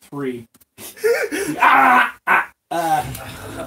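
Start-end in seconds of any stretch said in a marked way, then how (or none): 1.6-2.5 high, loud cries of alarm; short frightened shrieks, played up; panicked goblin yelp.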